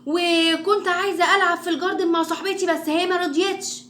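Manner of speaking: high, loud chatter to mum; noisy kid reporting the day at high pitch; too-loud child. Shown as a demonstration. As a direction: act out a child who talks too loudly. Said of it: "على طول عالي واحنا بنتكلم".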